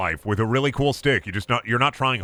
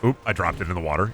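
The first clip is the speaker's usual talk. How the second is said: ordinary talk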